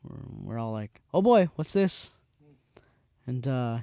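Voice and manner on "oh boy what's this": mock wary group. Group reacting to the idea.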